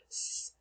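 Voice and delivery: "s" as a bare hiss, not the letter name; isolated s sound. Shown as a demonstration. Teacher shows the sound ending rinse, isolated "s". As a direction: hiss "s" alone with no vowel. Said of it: "to make the s sound".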